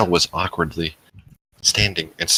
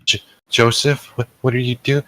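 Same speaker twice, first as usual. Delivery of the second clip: startled stammer; 8 semitones higher than usual. A narrator's reading. You startled as Joseph grabs your hips, stammering. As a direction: stumble over his name in surprise. Startled by his hands on her.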